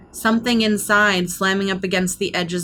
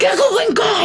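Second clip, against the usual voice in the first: high-pitched, grumbling recall; pitched way up, indignant; small high voice; high character voice; 13 semitones higher, 4 dB louder than usual. Acted.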